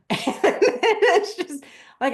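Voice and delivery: talking through a laugh, high and loud; slow words breaking into laughter; amused, laughing as they speak. Real, not acted. Laughing at own email struggle.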